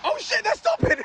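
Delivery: high, thrilled burst; sudden delight as the car pulls up; excited. Real, not acted. Hitchhiker seeing a car stop, high and excited.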